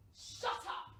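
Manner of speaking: harsh unvoiced hiss; hissed shut up at the boss; angry. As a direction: cut him off with venom. Snapping at the boss.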